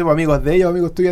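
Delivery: ordinary delivery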